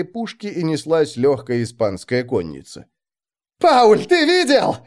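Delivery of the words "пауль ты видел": high, loud, slow excited yell; excited shout. Acted. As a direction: shout it out thrilled after battle. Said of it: "кричал Райснер".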